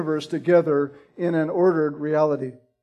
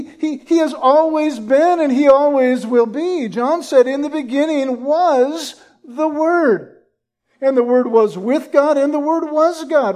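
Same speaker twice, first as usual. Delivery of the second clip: higher and louder fervent pulpit proclamation; preaching at full force; 7 semitones higher, 4 dB louder than usual. Acted. Preacher declaring Christ's eternity to the church, full-force fervent preaching. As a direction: proclaim it ringing out to the congregation.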